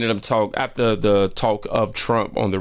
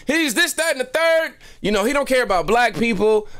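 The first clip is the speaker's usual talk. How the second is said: high-pitched indignant rattle of accusations; knee-jerk outrage, acted out way up high; instant outraged answer. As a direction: reel off the charges in a high, worked-up voice. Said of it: "when I say instant".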